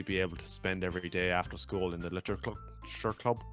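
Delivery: put-on light Irish accent reading. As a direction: read it in an Irish lilt. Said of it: "it's hard to talk in an Irish accent".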